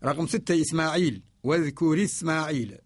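ordinary talk, nothing marked

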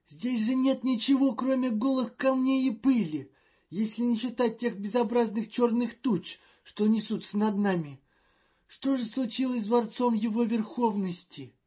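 high, loud and fretful; a jittery official in a panic; nervous diplomat voice. Acted. Magnan's anxious outcry.

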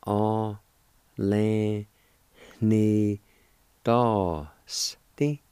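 "A, le, ni, dos, ti" broken into separate syllables. Shown as a demonstration. Teacher breaking the word into syllables for students. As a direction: pause after each syllable. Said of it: "It's pronounced like this".

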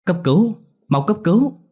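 high frantic cry for help; panicked shout. Acted. Ngân calling the doctors in panic, frantic shout.

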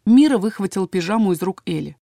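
ordinary delivery, nothing marked

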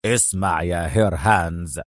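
low general's warning. A narrator's reading. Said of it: "لوح كروغر بسبابته في وجهه هاتفا". (بنبرة تحذير منخفضة)

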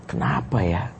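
soft, musing question; hushed, wondering to oneself; pondering aloud. Retold or imitated. Brother regretting a week of silence toward sister, quiet pondering. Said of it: "tapi saya berpikir".

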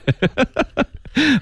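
light burst of giggles; laughing. Real, not acted. Teacher amused by own example word, giggling. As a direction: laugh at your own joke.

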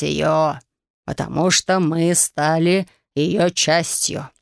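put-on old sage voice. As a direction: explain like a tired old man. Sage explains the machine.

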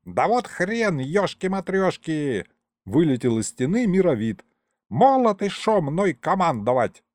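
the ancient ghost refusing orders, indignant. A narrator's reading. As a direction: protest in an old ghost's voice. Old sorcerer ghost protests.

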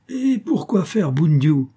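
high, slow incredulous question; a baffled captain's indignant comeback; exasperated question. A narrator's reading. Baffled captain answers the alarm. (question incrédule, exaspérée)

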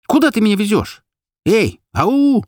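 high-pitched insistent demand; exasperated, high cry; agitated calling out. A narrator's reading. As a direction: call out for attention, pitched high. Acted panicked demand to driver.

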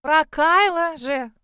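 loud, slow, eager exclamation; exclaiming. A narrator's reading. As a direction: burst out with excitement. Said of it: "воскликнула она".

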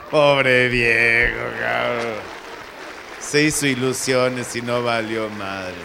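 loud, drawn-out mock pity; mock lament. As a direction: stretch the words in a half-sung lament. (lamento burlón, lento y alargado)

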